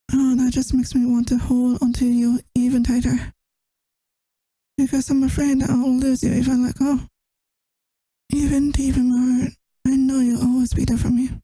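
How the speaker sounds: ordinary delivery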